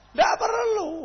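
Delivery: a preacher answering his own question up high; emphatic rhetorical answer. Real, not acted.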